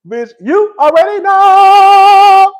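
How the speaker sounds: high, loud drawn-out holler; hype shout. Acted. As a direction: stretch KNOW into a long party holler. Hyping an imagined bounce performance.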